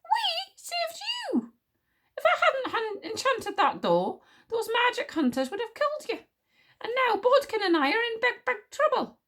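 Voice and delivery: high-pitched indignant scolding; outraged. A narrator's reading. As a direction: protest furiously, voice shooting up high. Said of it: "exclaimed Wish in outrage".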